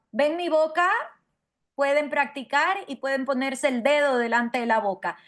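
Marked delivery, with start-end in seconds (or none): none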